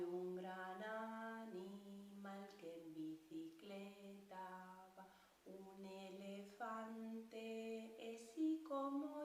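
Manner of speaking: very slow and soft, sleepy; very slow tortoise. Pretend. The tired tortoise sings very slowly and softly. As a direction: drag every word like a sleepy tortoise. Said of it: "va a cantar muy despacito".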